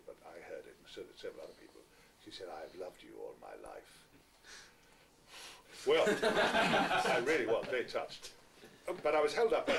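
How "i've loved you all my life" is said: soft, lower murmur of a passing woman; a quiet lower murmur; said quietly. Retold or imitated. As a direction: breathe it quietly as she slips past. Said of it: "not loudly".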